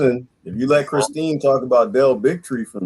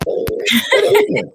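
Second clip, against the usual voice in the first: high silly greeting. Pretend.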